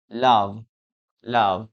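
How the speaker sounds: LOVE low, with a voiced V; model pronunciation. Shown as a demonstration. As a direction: say LOVE ending on a clear V. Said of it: "That is the last word".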